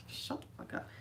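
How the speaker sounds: whispered mock retort. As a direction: whisper the retort under your breath. Retold snapping back, hushed.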